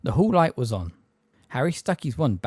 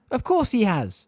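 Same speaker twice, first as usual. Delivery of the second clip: put-on higher, impatient old neighbour; 11 semitones higher, 4 dB louder than usual. A narrator's reading. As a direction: brush the question off impatiently. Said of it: "said Miss Fig impatiently".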